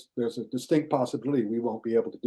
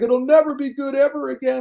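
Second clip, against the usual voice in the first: whiny critic; 11 semitones higher, 3 dB louder than usual. Retold or imitated. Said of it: "It's like".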